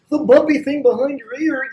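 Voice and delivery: high, loud goofy voice mocking a clueless teacher; mock dumb explanation. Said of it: "people talk like that when they're teaching".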